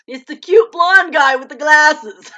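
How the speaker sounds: loud, slow, gushing delight; gushing excitement. Real, not acted. Vlogger gushing to viewers about the cute actor, loud and slow. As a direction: swoon over him out loud.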